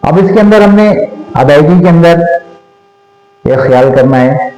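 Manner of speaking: usual speaking voice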